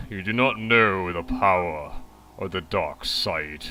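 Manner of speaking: low, slow, dark villain voice; Vader. Acted. Gamer reacting to a friend request message, low villain impression. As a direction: drop the pitch into a measured dark-lord voice. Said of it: "as well as I thought that it would for Vader".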